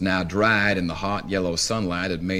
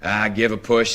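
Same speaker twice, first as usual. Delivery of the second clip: ordinary delivery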